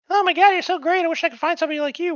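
put-on high, fast, gushing girly voice; mock gushing girl. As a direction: gush like a smitten girl. Mocking the girl's gushing.

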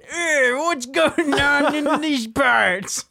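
put-on slow, high old prospector voice. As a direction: drawl it like a grizzled gold miner. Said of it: "you sound like an old prospector".

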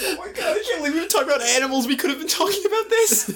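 high, rushed words through laughter; laughing. Real, not acted. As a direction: gasp it out mid-laugh. Cracking up at secret soup.

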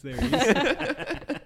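mock proud reveal. Joking about Snake hatching his son, slow high mock pride. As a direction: present the kid like a prize.

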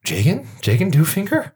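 surprised recognition. Acted. Elf writer opening the door to an old friend, rising amazement. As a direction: pitch climbing on the name as it dawns on her. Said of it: "She's like".

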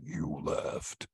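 low, whispered growl of a menacing bear; imitating the slasher Pooh's low growl. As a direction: breathe it out low and menacing, like a monster Pooh. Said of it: "a low growl almost".

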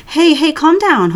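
slow soothing plea with a hint of panic; calm him down slowly. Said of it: "tries to keep his voice soothing".

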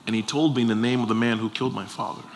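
normal talking voice

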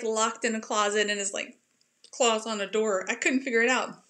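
usual speaking voice

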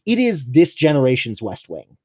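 ordinary talk, nothing marked

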